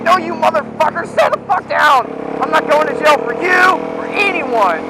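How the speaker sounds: angry yelling. Retold or imitated. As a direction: snap at them to sit down, half-acted. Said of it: "yelling at everybody".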